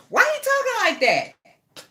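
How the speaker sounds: high-pitched, drawn-out mock astonishment; retold disbelief. Fan retells first hearing the actor's English accent, high-pitched disbelief. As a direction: stretch it out high, as if baffled by his voice.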